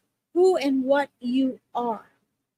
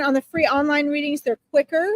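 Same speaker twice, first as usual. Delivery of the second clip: ordinary delivery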